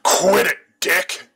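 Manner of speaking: put-on angry girl. A narrator's reading. Girl struggling, flailing.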